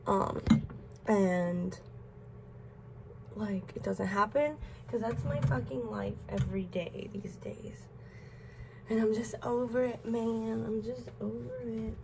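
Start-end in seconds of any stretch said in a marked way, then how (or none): 4.9-7.8 hushed, breathy confession under the breath; a whispered gripe about everyday life; whispered aside. Real, not acted. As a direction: let it slip out as a whisper.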